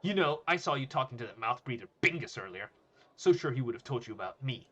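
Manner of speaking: loud, emphatic bully; confronting bully. Acted. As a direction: push the words at them.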